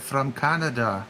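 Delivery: a clumsy mock Canadian accent, slow; drawn-out fake Canadian accent on Canada; hammed-up Canadian accent; bad Canadian accent. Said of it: "That's a terrible accent".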